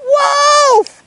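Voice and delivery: high shouted cry; cry of wolf. Acted. Boy cries wolf from the hill, high loud shout. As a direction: shout it across the hills. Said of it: "Nick cried".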